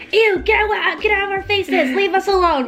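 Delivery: mock disgusted brush-off. Friend imitating her confrontational pal, high mock disgust. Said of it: "She likes being like".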